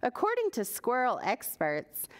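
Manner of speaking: usual speaking voice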